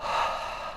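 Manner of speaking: an unvoiced breathy sigh for the "h" sound. Shown as a demonstration. Teacher showing children the "h" sound. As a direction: exhale the h like a sigh. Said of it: "H says".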